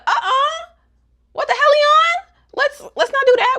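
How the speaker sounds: high-pitched, loud retold protest; hammed-up "hold on a second" reaction; put-on scandalized squeal; mock alarmed objection. Objecting to a grabby advance.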